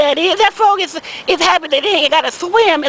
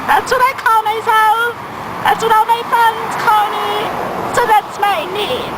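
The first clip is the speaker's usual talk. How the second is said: put-on higher, airy young woman voice; girl's voice; 5 semitones higher than usual. Girl insisting on her name, higher pitched. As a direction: say it proudly in a light girlish voice. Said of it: "And she said".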